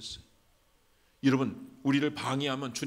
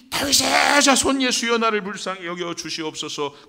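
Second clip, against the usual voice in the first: desperate cry; 10 semitones higher, 13 dB louder than usual. Acted. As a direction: cry out with all your might, then let it fall. 바디메오의 부르짖음 재연. (온 힘을 다해 부르짖는 소리)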